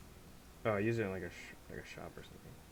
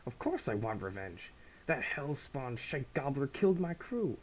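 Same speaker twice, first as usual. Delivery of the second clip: slow, higher, snarling pirate captain vowing revenge; vengeful pirate captain; 5 semitones higher, 5 dB louder, slower than usual. Acted. Pirate captain swears revenge for her crew, slow and vengeful. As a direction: spit it out like a wronged pirate queen.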